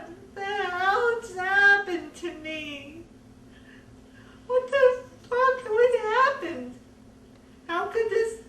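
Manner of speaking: loud, high, drawn-out crying; anguished wail. Retold or imitated. Survivor reliving lying in her driveway, anguished wail. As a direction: wail it out in tearful disbelief. Breaks into wailing reliving the attack.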